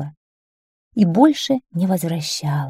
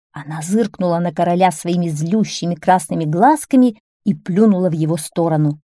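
usual speaking voice

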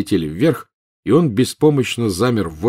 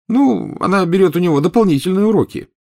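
improvised; 11 semitones higher, 4 dB louder than usual. Acted. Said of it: "наобум ответил Гарри".

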